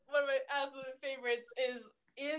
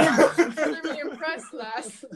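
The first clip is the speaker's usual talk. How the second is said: bad Scottish accent; 2 semitones higher, 7 dB louder, slower than usual. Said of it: "I can't do the accent at all".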